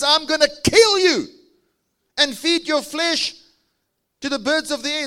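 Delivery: high, loud giant's threat; menacing giant. Acted.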